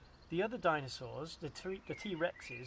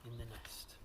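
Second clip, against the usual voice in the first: ordinary delivery, nothing marked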